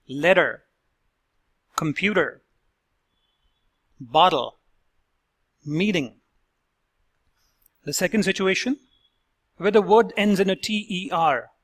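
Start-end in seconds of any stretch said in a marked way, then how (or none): 0.1-6.2 careful, separated model words; flapped T model words. Shown as a demonstration. Accent coach demonstrating the fast D T. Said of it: "let's hear the words".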